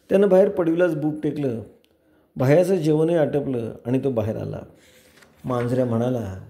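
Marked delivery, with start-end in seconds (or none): none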